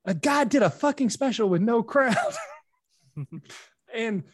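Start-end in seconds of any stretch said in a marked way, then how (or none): 0.0-2.2 incredulous. Retold or imitated. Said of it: "and Norm says".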